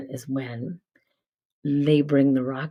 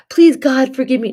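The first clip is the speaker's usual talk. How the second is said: much higher, louder, begging; mock desperate plea; 11 semitones higher, 10 dB louder than usual. Mocking viewers who beg God, high loud plea. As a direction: cry out to God in hammed-up pleading. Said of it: "Not God".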